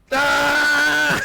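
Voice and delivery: loud high-pitched scream from the stall; mock scream. Retelling the bathroom kid, a loud high-pitched shriek. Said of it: "And he goes".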